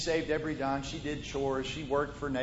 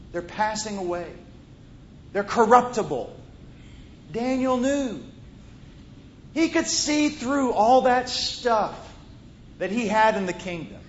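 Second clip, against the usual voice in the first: full-force preaching; 4 semitones higher, 7 dB louder than usual. Acted. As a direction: preach it out loud, leaning into every phrase.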